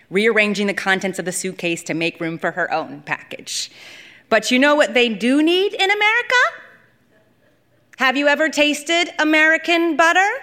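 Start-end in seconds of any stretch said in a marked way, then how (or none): 4.3-10.3 eager boast. Acted. Lula boasting about American butter.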